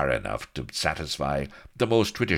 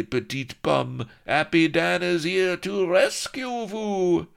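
very high, loud, slow Frenchman; hammed-up French pirate boasting to the lady; French accent boast; 6 dB louder than usual. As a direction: crow out a gallant rescue. Happy Dan's boast.

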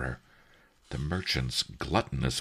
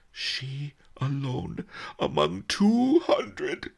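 higher, slow, a touch of mock sobbing; 10 semitones higher, slower than usual. Old sage fakes mourning before merchant, slow mock sob. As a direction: draw out the fake grief. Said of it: "Master Li sobbed".

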